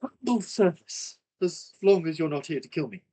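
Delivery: bow with words, humble yet wary; courtly elf. Acted. Elf offers service.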